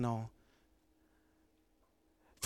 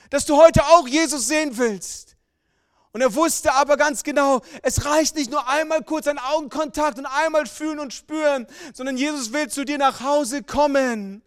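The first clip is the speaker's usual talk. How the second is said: loud, high, rising sermon call; booming revival preacher; full-force preaching; 12 semitones higher, 10 dB louder than usual. Acted. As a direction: preach it out with fire to the congregation.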